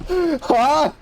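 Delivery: high, baffled "Huh?"; a sharp high note of disbelief; stunned huh. Retold or imitated. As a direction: caught off guard mid-drive, a high startled huh. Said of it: "I never exclaimed".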